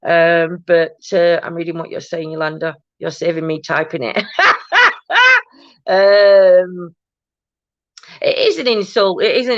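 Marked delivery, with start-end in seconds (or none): 4.3-5.4 sudden high, loud laugh; real laugh bursting out high and loud; burst of laughter. Real, not acted. Laughing at viewer's comment.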